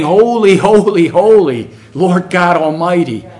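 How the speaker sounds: loud, high cry of holy, holy, holy; loud worship cry. Pretend. On your face before God crying out loud praise.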